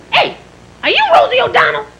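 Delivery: high, pushy mock lady voice; brash stranger. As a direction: accost a celebrity in a high put-on voice. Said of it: "this lady said to me".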